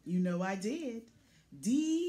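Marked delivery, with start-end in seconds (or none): 1.6-2.1 higher, louder triumphant call. Acted. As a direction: announce it with pride. Gravel load delivered.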